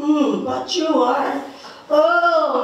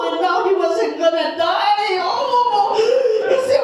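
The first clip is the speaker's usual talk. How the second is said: ordinary delivery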